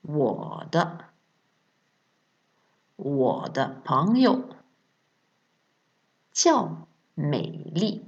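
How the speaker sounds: "wo de pengyo jiao Mélie" slow, with pauses between parts; slow model answer. Shown as a demonstration. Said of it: "répétez après moi".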